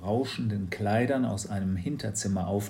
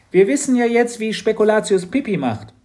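put-on high, loud girl's voice; a bright, bossy little girl; Mathilda's voice; 11 semitones higher, 10 dB louder than usual. Mathilda speaks to Matz. (hohe, laute Mädchenstimme)

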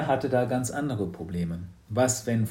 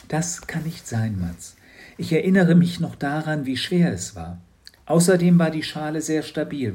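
a hint of a curious old shopkeeper; gently probing, lightly voiced; Frau Kramer, lightly voiced; 5 semitones higher, 5 dB louder than usual. Frau Kramer questions Matz. (leicht gesprochene, neugierige Frau Kramer)

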